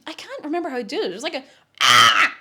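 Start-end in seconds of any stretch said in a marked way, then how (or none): 1.8-2.3 loud, much lower scream; Tom scream impression. Shown as a demonstration. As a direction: roar it low from the throat. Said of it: "Kinda like that".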